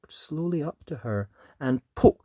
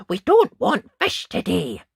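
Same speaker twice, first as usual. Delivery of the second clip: rude refusal; 7 semitones higher, 8 dB louder than usual. Acted. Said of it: "Ned told the fisherman".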